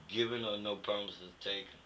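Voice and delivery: normal talking voice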